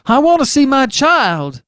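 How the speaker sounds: very high, loud, slow desperate cry; almost shriek it; desperate father at the end of his nerves; near shriek. Acted. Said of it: "Mister Button almost shrieked".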